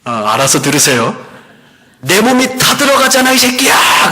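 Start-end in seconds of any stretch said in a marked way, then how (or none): 2.0-4.1 high, sustained acted shout of abuse; possessed woman's shriek. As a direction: imitate a demon-possessed woman screaming in outrage. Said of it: "그러는 거예요".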